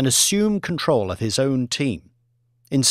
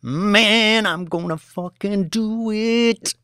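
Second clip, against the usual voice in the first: scream; 9 semitones higher, slower than usual. Acted. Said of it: "could be heard screaming".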